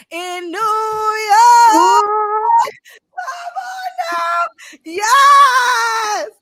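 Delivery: very high, drawn-out cheer for New York; cheering a hometown; hype shout. Real, not acted. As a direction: holler it like a hype man. Hyping guest's hometown.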